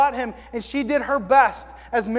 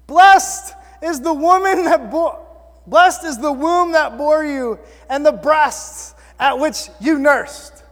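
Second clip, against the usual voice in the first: loud, higher proclamation from the crowd; raised voice; 6 semitones higher, 9 dB louder, slower than usual. Acted. Woman in the crowd calling out to Jesus, raised voice. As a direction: call it out slowly over a crowd. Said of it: "a woman in the crowd raised her voice".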